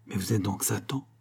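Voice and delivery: hushed, frightened question; dread. A narrator's reading. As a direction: ask it softly, in awe and fear. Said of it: "regardant cet homme avec effroi". (effroi à voix basse)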